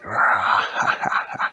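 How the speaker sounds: breathy mock evil cackle; hammed-up horror villain; spooky villain laugh. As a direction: laugh like a lurking monster. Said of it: "a spooky man named Freddy Krueger".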